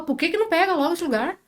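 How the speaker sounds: high-pitched retold protest; retold pleading complaint. Retelling her prayer to leave that place, pleading.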